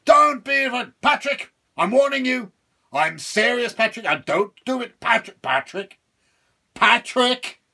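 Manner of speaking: high, loud, threatening devil; furious devil. Acted. Boxed devil warning Patrick not to do it. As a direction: warn him with fury.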